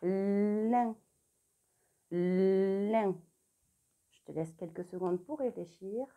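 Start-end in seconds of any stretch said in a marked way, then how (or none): 0.0-1.0 "lin" with a long held l, then the vowel; drawn-out dictation. Shown as a demonstration. Teacher dictates a syllable to pupils, "lin" with a long l. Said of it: "On va écrire la syllabe". 2.1-3.2 "Lin" with a long held l, then the vowel; drawn-out dictation. Shown as a demonstration. Teacher repeats the syllable for pupils, "Lin" with a long l. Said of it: "On va écrire la syllabe".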